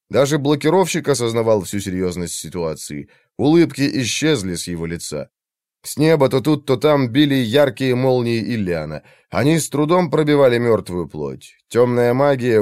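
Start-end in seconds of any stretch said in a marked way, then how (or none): none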